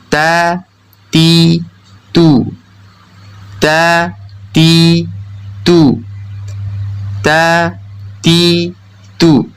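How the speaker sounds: ta, ti, tu with tongue tip pressed behind upper teeth; drawn-out demo of Arabic ta in "ta, ti, tu"; ta pronunciation. Shown as a demonstration. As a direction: dental T in "ta, ti, tu". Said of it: "harus dipastikan benar-benar menempel".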